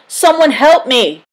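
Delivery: high, loud cry for help; trapped innkeeper shouting for rescue; panicked shout. A narrator's reading. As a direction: yell from the window. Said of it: "he shouted".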